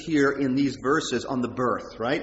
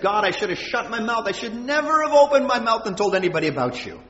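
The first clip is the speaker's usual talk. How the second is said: higher-pitched, louder regretful outcry; despairing lament; 7 semitones higher, 4 dB louder than usual. Acted. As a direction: cry out to God in acted despair. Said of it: "he's despairing".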